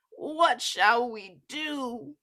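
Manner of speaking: slow, loud despairing question. Acted. Mother lamenting to Jack, slow. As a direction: ask it in hopeless despair. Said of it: "she said".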